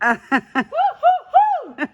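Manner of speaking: loud high woo, laughing; calling down a hallway. Real, not acted. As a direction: whoop it out to hear an echo. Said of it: "Oh, it echoed".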